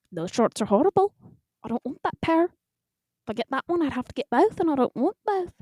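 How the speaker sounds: fussy, lilting fake Irish grumble; bad Scottish-Irish brogue, pitch climbing; bad Irish accent. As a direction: complain to yourself in clumsy Irish. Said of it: "maybe Scottish-Irish fusion".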